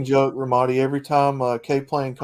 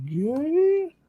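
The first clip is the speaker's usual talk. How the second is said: mock disbelief. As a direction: squeal it like you can't believe it.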